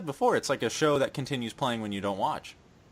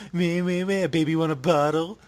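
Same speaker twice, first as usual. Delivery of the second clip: mocking blah blah. Mocking babble of Rhea.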